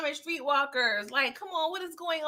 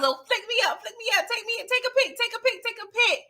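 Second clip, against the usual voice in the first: much higher, begging fangirl chant; eager groupie; 10 semitones higher than usual. Retold or imitated. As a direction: pester for a photo like a starstruck fan. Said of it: "She was asking the girls".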